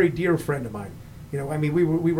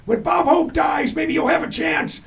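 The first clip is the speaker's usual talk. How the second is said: much higher, slow mock heckler; heckling old guy; 10 semitones higher than usual. Comic imitates old crowd heckling Bill at a Holiday Inn, high mock voice. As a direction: put on a cranky old audience member, high and slow.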